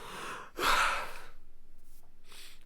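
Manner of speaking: long breathy exhale after an outburst; long sigh. Pretend. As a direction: let the air out slowly. Comes down after his outburst.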